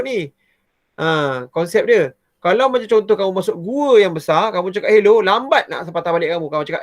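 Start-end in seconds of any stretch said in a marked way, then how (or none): 4.9-5.2 calling out. Shown as a demonstration. Calling out in a big cave.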